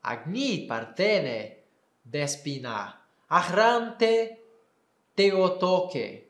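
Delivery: slow Koine reading of the hymn; Koine Greek reading. Shown as a demonstration. Teacher reading the Greek hymn aloud in Koine. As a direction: read the hymn line calmly in Koine. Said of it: "Então, eu vou ler".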